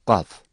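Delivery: Kaf from the tongue root, thick and strong; Arabic qaf demo; deep throat Kaf. Shown as a demonstration. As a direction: drop Kaf deep with a heavy K. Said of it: "Kalın ve kuvvetli bir sesle okunur".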